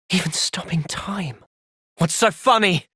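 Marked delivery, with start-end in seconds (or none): 0.1-1.4 slow, awed whisper. Acted. Companion whispers in awe at the enemy's power. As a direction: breathe it out in awe.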